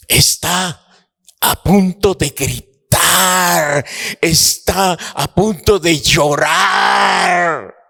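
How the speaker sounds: high, slow, near-shouted sermon climax; impassioned preaching. Acted. Preacher telling the congregation of Abraham's agony, in an impassioned near-shout. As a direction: cry out the father's anguish slowly.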